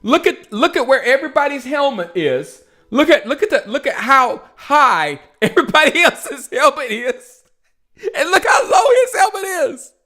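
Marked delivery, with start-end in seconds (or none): 2.9-9.9 high, laughing disbelief; much higher, delighted and amazed; excited. Real, not acted. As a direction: cry it out in amazement. Amazed at low helmet.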